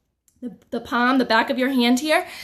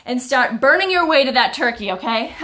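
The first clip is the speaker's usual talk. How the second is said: usual speaking voice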